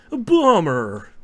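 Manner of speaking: totally eighties voice. Retold or imitated. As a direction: imitate a game's eighties-style miss callout. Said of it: "in, like, a totally eighties voice".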